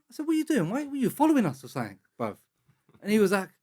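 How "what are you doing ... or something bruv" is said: high-pitched, quick-fire questioning; retelling an indignant confrontation; retold challenge. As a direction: challenge a follower, pitch jumping up. Retold challenge to men following them.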